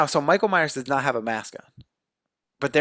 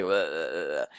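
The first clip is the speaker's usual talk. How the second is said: low, soft mock death groan; imitated dying groan; 10 semitones lower, 6 dB quieter than usual. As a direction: groan like a dying movie victim. Imitates dying boy's noise.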